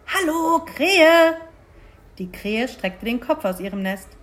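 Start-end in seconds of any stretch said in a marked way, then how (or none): none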